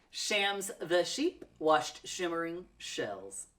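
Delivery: tongue twister said slowly and evenly. Shown as a demonstration. Teacher repeats tongue twister for learners, slow. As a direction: take it slow and steady. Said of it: "Try it again".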